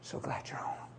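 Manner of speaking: hushed loving reply; barely above a whisper; soft tender wife. Retold or imitated. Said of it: "She said".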